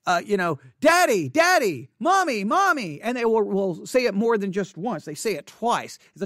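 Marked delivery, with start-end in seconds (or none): 0.8-3.0 put-on higher, louder child calling for a parent; lightly voiced kid calling daddy; imitating a little child tugging at a parent. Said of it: "like a child".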